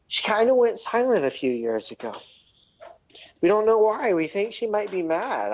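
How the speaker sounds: nervous gnome confiding in the party; worried gnome. Acted. Gnome NPC explains silent goddess.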